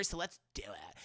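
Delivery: breathy mock whisper, hushed. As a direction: drop to a secretive whisper. Anastasia agreeing to bondage.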